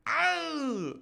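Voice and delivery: high falsetto yelp; echoed cry. Acted. Reader voicing the wounded man's cry, high falsetto yelp. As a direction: yelp like the fallen man. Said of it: "the cry he gave was echoed".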